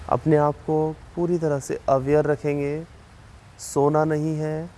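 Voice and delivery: slow, measured relaxation guidance with long pauses; unhurried yoga nidra instructor, slightly softened; guided meditation. Real, not acted. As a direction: guide them calmly through breathing, drawing out counts and cues. Leads a yoga nidra session.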